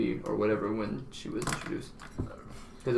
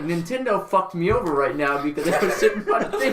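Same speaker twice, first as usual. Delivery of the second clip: higher and louder, laughing through it; laughing disbelief; 6 semitones higher, 5 dB louder than usual. Retold or imitated. Retelling security blaming his Switch, higher, louder, laughing. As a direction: laugh while retelling the absurd accusation. Retold outburst at airport security.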